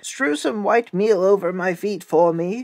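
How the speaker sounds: a touch of high, put-on wolf; wolf's softened voice. A narrator's reading. Wolf asks miller in softened voice.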